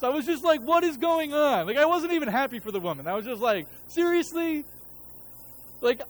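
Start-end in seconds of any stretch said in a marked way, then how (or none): 0.6-1.7 exasperated. Retold or imitated. Upset pastor's reaction. 3.9-4.6 incredulous. Retold or imitated. Unhappy for the woman.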